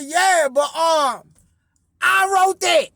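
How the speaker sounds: high, loud protest; insistent shout. Retold or imitated. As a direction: yell it like you're claiming credit. Retold yelling at his dog.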